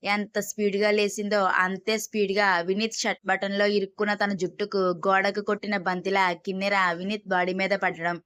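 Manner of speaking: plain reading voice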